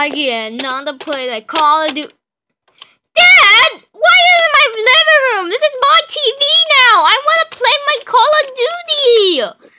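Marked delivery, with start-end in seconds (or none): 3.1-9.7 much higher, louder demanding yell; angry kid shout. Acted. Son yelling at dad over the TV, angry.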